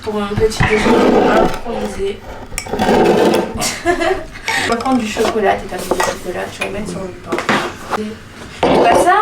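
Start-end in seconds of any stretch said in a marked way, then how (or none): 2.2-4.6 breathy laugh. Real, not acted. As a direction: laugh under your breath. Laughing at her dictated breakfast.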